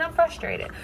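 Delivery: mildly exasperated quote of oneself; retold frustration.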